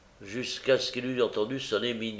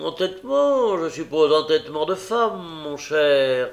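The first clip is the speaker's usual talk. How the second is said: put-on old schemer voice; 6 semitones higher, 6 dB louder than usual. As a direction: muse aloud, pitch swooping up.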